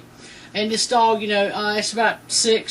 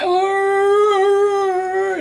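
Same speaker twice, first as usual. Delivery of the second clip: a sudden high, loud pained yelp, like an injured dog; imitated dog yelp of pain; 9 semitones higher, 5 dB louder than usual. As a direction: cry out the way the hurt dog did. Dog lying in pain after hit.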